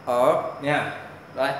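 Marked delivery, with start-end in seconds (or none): 0.0-0.3 "Ở" from the throat with an r colour; throaty, tongue-curled Ở. Shown as a demonstration. Teacher repeats for learners, throaty curled "Ở". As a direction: curl the tongue and sound "Ở" in the throat. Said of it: "Phát âm từ cổ họng".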